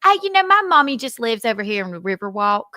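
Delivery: playing up a Southern accent; put-on Southern accent. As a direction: lay on a courteous Southern accent for a cop. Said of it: "I put on my Southern accent".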